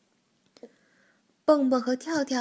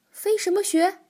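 baffled question; 8 semitones higher, 4 dB louder than usual. A narrator's reading. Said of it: "蹦蹦和跳跳异口同声地问道".

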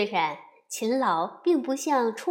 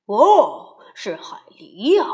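loud, knowing papa bear; a touch of a grumbling dad; 11 dB louder, slower than usual. Acted. Papa sees beavers.

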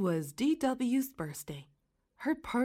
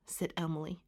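usual speaking voice

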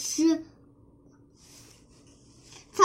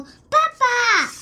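eager call; 7 semitones higher, 5 dB louder than usual. Acted. Child calls father on return.

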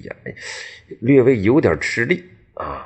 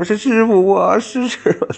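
much higher, louder eager youth; eager young apprentice; 5 dB louder than usual. Acted. Erniu answers his master, higher and louder in eager relief. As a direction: answer bright and breathless like a kid. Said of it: "二牛说".

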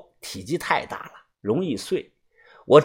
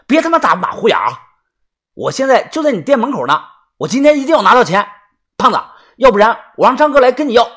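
rapid, raised, pressing threat; angry demand; 6 semitones higher, 5 dB louder, faster than usual. Acted. Creditor at the shop door to the debtor, angry demand. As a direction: push hard and fast for the money.

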